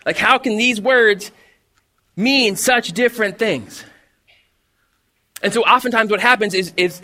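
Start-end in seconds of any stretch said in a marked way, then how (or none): 0.0-3.9 slow puzzled. Retold or imitated. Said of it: "And you're like".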